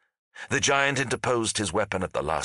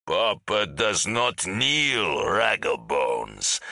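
slow, drawn-out giant's bellow; much slower than usual. A narrator's reading. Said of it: "the giant bellowed".

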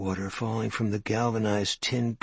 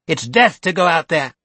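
higher, louder sharp comeback; smart retort; 7 semitones higher, 10 dB louder than usual. Acted. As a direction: snap back with dark certainty. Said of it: "came back smartly".

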